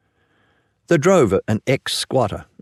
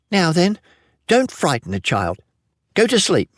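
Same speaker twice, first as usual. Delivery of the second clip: scolding mother; 8 semitones higher than usual. A narrator's reading. Mother scolds boy at bedtime.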